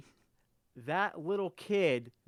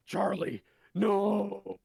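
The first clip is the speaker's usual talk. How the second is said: wolf crying out its friend's name, rising louder; a big wolf's loud anguished cry for its fallen puppet; 7 semitones higher, 4 dB louder, much slower than usual. Acted. GM voicing the wolf over its lost puppet, anguished loud cry.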